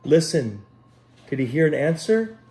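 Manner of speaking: gentle storybook reading voice; unhurried picture-book narration; storytelling. Acted. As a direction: read it like a bedtime story for children. Children's story read aloud.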